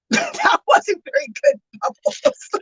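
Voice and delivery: high, squeaky laugh through the words; real laughing. Real, not acted. Streamer laughing at a failed bubble trick, laughing. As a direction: talk through a giggle fit.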